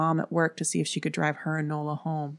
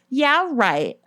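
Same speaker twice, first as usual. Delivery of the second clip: high, loud dismissive scoff; 9 semitones higher, 5 dB louder than usual. A narrator's reading. Sybil dismissing Erin's warning, scoffing. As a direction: throw it back in disbelief. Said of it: "she scoffed".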